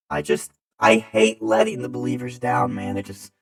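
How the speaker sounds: loud, hammed-up wail of self-pity; mock sad-boy lament. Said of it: "It's sad boy hours right now".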